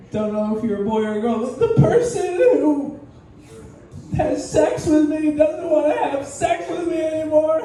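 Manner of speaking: high tearful whine; mock crying whine. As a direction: whimper about your boss like a sad kid. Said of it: "You're like".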